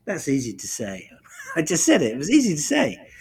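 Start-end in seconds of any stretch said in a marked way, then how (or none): 1.3-3.1 laughing. Real, not acted. Laughing at own remark.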